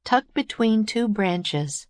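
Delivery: ordinary delivery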